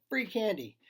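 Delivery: high, loud street call; yelling. A narrator's reading. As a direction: call it out to the whole town. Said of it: "through the town yelling".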